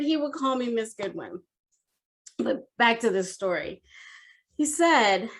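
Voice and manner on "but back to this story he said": usual speaking voice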